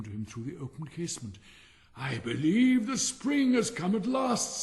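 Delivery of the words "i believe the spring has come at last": slow, much higher and louder wonder; hopeful giant. Acted. Giant waking to birdsong, slow hopeful exclaim. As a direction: let the hope swell as he wakes to birdsong.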